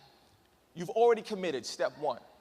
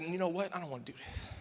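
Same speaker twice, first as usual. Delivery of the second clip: much softer, chickening out; backing out; 2 semitones lower, 9 dB quieter than usual. Retold or imitated. Speaker at the ledge, soft backing-out mutter. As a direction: let it fade as courage fails.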